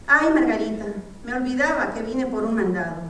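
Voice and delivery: ordinary talk, nothing marked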